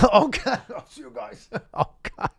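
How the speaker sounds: high, helpless laughter through the words; real laughing fit. Real, not acted. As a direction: crack up until the voice gives out. Cracks up at own impression.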